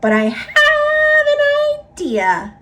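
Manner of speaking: very high, squeaky little bat. A narrator's reading. Little bat offers its plan in a very high voice. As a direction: pipe it up like a tiny bat. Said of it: "said the bat".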